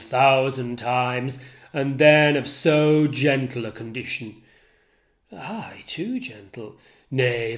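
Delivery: jealous Othello raging at Iago; enraged Othello. Acted. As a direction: let the fury burst through the lines.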